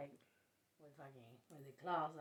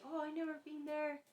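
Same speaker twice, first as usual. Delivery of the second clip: mimicking mom, high; 10 semitones higher, 6 dB louder than usual. Mom's reply quoted in story.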